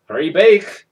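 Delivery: loud call from the doorway; mother calling her son, loud. Acted. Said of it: "his mother called".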